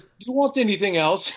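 a light put-on of Scott's accent; imitating Scott's accent. [name] quoting the McDonald's order-taker in Scott's accent. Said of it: "your type of accent, Scott".

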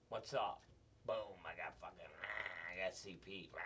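low, soft put-on groaning; mumbled mock impression, low and quick. Acts out having CP.

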